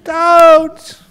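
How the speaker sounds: loud high braying scream; donkey screaming at the farmer to stop. Acted. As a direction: scream like a donkey being buried. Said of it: "the donkey really started screaming".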